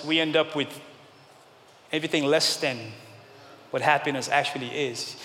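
ordinary delivery

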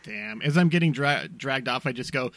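usual speaking voice

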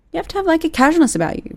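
high, light and easy; casual. Shown as a demonstration. Manager telling others to sound casual, breezy and high. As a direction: voice lifted high, casual and unbothered. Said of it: "is casualness".

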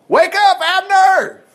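high, loud wake-up call; David shouting to the sleeping camp. Acted. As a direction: holler across the valley to wake him. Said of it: "David shouted in the early morning".

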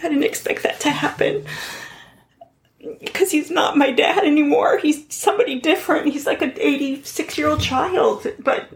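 fighting back real tears mid-story; starting to cry. Real, not acted. Daughter telling the host about her father, tearful. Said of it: "I'm gonna start crying".

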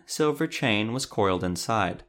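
plain reading voice

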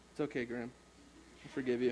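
calm forgiveness. Pretend. Speaker to Graham after the crash, softly forgiving. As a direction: forgive him quietly. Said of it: "contrast with own reaction".

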